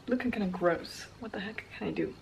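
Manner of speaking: hushed, quick grumble; muttered whisper to herself; whispered self-critique. Real, not acted. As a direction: whisper it to yourself in the mirror. Judging her unwashed hair.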